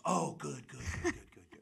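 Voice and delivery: lightly voiced hype-man impression, trailing away; put-on relieved reply, dying off quickly. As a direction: repeat it as it fades into a laugh. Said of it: "And he goes".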